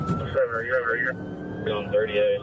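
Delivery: usual speaking voice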